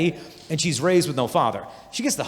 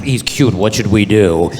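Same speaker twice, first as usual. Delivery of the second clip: mock girly plotting; 7 semitones lower, 9 dB louder, slower than usual. Girls huddle plotting.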